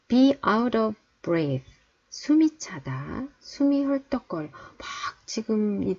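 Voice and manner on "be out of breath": very slow, each word drawn out; slow teacher demo; slow model reading. Shown as a demonstration. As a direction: spell it out slowly for learners. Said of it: "teacher models phrase slowly".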